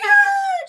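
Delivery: high loud yell of Ah; frustrated scream. Retold or imitated. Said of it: "He's like".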